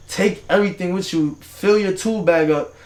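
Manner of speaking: ordinary delivery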